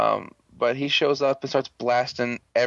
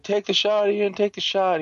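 mock urging; 8 semitones higher than usual. Retold plea to shoot the monster.

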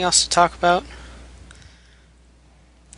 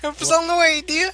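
mock rescuer; 11 semitones higher than usual. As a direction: reassure like a hammy rescuer.

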